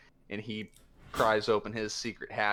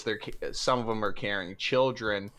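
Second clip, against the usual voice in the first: ordinary delivery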